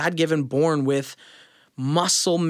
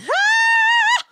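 loud, piercingly high held falsetto note; extremely high note; 7 dB louder than usual. Shown as a demonstration. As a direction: sing a sustained top note. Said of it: "able to sing extremely high".

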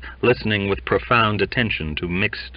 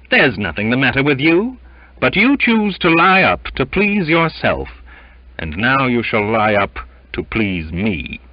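loud, raised, commanding rebuke; severe captain; 4 semitones higher, 5 dB louder than usual. A narrator's reading. Captain confining a malingerer below, stern and forceful. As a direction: lay down the law to crew and mate. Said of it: "retorted Captain Alastoun with severity".

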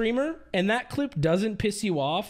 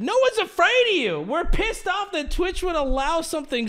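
higher, louder and fast, fired straight back; angry outburst; 6 semitones higher, 3 dB louder than usual. Real, not acted. Streamer yells back at the clip in real anger. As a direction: shout her threat down in real anger.

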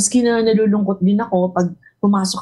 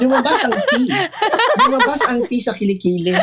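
a higher, laughing posh tea-drinker; laughing mock posh; 6 semitones higher than usual. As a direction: ham up a sweaty lady at tea, giggling.

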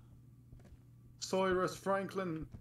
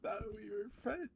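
1 mock hurt ghost voice; 8 semitones higher than usual. Teasing his friend as a sulking ghost in a high voice. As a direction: play a wounded ghost pleading.

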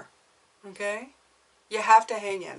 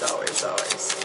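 whispered echo. Acted.

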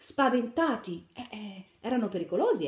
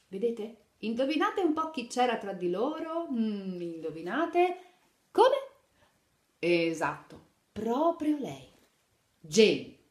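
usual speaking voice

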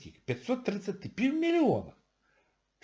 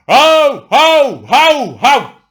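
loud, high barking yaps, mocking people; mock dog barking; 8 semitones higher, 15 dB louder, much slower than usual. Mocking the public's outcry over devaluation, loud barks. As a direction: bark like an angry dog.

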